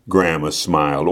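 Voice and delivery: plain reading voice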